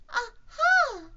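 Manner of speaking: very high, loud squeaky "aha"; Piglet's aha. Acted. Piglet trying again on Kanga, loud squeaked aha. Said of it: "said Piglet again".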